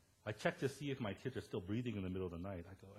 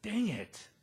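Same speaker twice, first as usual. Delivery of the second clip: caught-out exclamation; 10 semitones higher, 7 dB louder than usual. Retold or imitated. Caught out by her words.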